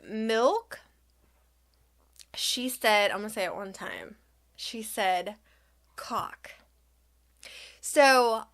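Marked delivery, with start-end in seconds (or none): 3.1-6.5 quiet, guarded aside; reluctant, hushed. Real, not acted. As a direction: drop your voice for the taboo word. Reluctant to say taboo word.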